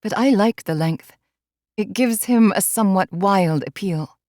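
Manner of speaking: usual speaking voice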